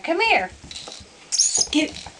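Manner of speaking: normal talking voice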